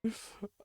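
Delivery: high giggle; amused squeal; laugh. Real, not acted. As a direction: burst into a giggle. Laughing after innuendo.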